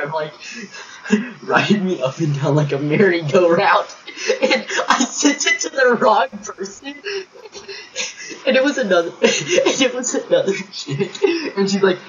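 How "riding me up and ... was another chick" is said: words breaking up into real laughter; can't get it out for laughing, high and giggly. Real, not acted. To friends about the wrong Snapchat, laughing through it.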